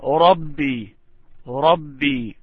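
ربي said in isolation, long final "ee"; careful drilled ربي; pronunciation model. Shown as a demonstration. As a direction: isolate ربي with a falling end. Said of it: "you can repeat after me".

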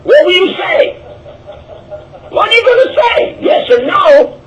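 hammed-up high shout, yes or no; mock shouting heckler. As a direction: press him hard, half yelling. Voicing pushy questioner, shouting.

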